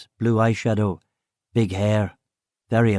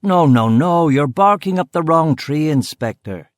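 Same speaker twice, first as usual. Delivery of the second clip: higher-pitched, emphatic rebuttal; animated; 5 semitones higher, 4 dB louder than usual. A narrator's reading. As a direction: correct the inspector with animated force. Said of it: "becoming animated".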